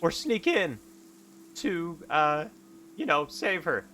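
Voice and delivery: high, loud and urgent pleading; desperate hero. Acted. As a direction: beg your old mentor, rising panic. Desperate hero begs for help.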